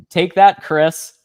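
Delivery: loud, high taunt; triumphant gloat. Real, not acted. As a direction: rub it in his face. Gloating at a friend.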